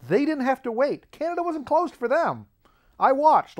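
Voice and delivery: high-pitched, aggrieved protest; comic indignation. Acted. Traveler complains others didn't have to wait, indignant.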